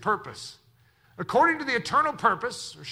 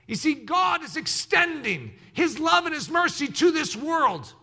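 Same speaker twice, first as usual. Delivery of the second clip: preaching at full force; 8 semitones higher than usual. Real, not acted. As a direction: build each phrase into a ringing shout. Preaching climax over music.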